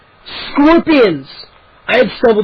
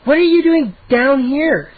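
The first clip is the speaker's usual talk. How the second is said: higher, slow scolding; impatient scolding; 6 semitones higher, slower than usual. A narrator's reading. Cousin scolding the lost boy impatiently. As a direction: scold him like a child. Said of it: "Sari cried, impatiently, as if scolding a child".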